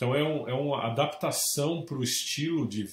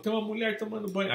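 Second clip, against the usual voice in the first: startled report; 11 semitones higher, faster than usual. Retold or imitated. Client reporting woman in shower.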